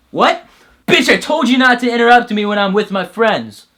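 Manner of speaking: loud, high scolding of an interrupter; mock-furious bro yelling at a pest; angry snap. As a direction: bark at him for cutting in. Snapping at an interrupter.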